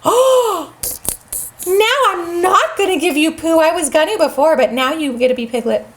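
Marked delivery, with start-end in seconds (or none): 0.0-0.8 offended gasp. Real, not acted. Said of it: "'Cause you were mean".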